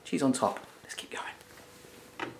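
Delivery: whispered, slow aside. Real, not acted. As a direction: whisper it to yourself. Whispered while sprinkling cheese.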